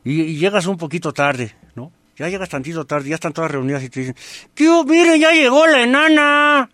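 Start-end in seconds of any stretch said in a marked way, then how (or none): 4.5-6.7 high, loud mock heckle across a crowd; loud, high-pitched teasing greeting; taunting call-out. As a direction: sing out the taunt loud and high, stretched at the end. Acted friends calling out a taunt.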